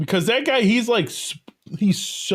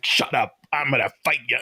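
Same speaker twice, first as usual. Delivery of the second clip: lower, imitating a crazy Chicago accent; a hammed-up Chicago threat, much lower; mock Chicago tough guy; 7 semitones lower, faster than usual. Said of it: "this crazy accent".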